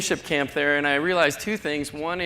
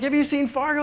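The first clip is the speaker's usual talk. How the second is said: light sing-song stranger, higher pitch; mocking a clueless stranger; 10 semitones higher, 3 dB louder than usual. A traveler quizzes the Fargo native, lightly mocking. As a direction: tease the tourist's question, higher. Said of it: "People are like".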